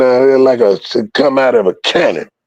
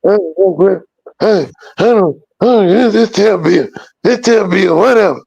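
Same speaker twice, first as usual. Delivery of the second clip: high, rushed, flustered jabbering; a retold friend losing his cool, high and fast; flustered emotional babble; 9 semitones higher than usual. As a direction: gabble it out in a panic, voice up high. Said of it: "He done got emotional".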